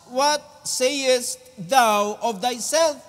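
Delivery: drawn out very slowly; slow King James reading; slow solemn reading. Acted. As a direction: stretch every word of the question. Reading scripture question.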